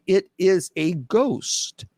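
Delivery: slow, drawn-out cry of the frightened disciples; dramatic terrified cry. Acted. Reading scripture to viewers, slow dramatic terrified cry. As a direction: stretch it out like a scared disciple.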